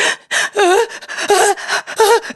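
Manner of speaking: high, heaving mock gasps; put-on panicky gasping; faked hyperventilating. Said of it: "heaving and hyperventilating".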